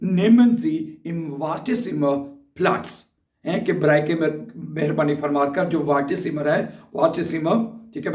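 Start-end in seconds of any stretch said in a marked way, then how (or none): 0.1-2.9 very slow, every German word spelled out for learners; slow dictation. Shown as a demonstration. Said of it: "तो ये कहेंगे".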